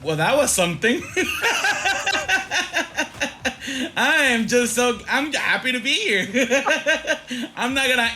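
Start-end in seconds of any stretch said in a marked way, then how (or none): 1.1-3.9 high breathy laugh. Real, not acted. Laughing at the old-timey bit.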